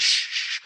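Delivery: two quick shushes; imitated shushing. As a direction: hush the group sharply.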